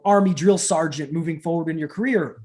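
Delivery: ordinary talk, nothing marked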